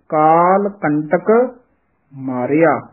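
slow, with a clear break between ਕੰਟਕ and ਮਾਰਿਆ; ਕੰਟਕ ਮਾਰਿਆ separated, never run together as ਕਮਾਰਿਆ; words kept apart. Shown as a demonstration. Teacher models the split ਕੰਟਕ ਮਾਰਿਆ, slowly. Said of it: "ਨਖੇਰ ਕੇ ਪੜ੍ਹਨਾ ਹੈ".

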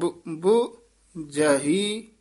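ਬੁ- then ਬੁਝਹੀ, slow with a break; syllable split. Shown as a demonstration. Teacher breaks ਬੁਝਹੀ into parts for the student. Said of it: "ਇੱਥੇ ਵੀ dash ਦੇਣੀ".

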